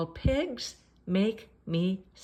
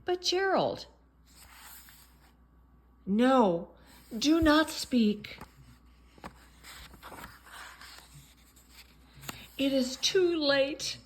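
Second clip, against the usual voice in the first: melodramatic voices; 6 semitones higher than usual. Acted. Piggy and Gerald melodrama.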